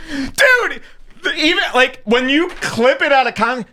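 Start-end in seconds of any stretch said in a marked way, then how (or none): none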